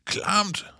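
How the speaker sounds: very high yelp of disgust; disgusted cry. A narrator's reading. Kalle just got soaked from the tree, disgusted cry. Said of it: "råber Kalle".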